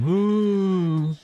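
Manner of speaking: high, hammed-up gasp; mock shocked gasp. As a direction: gasp like a pearl-clutching whiner. Mocking defensive players' outrage.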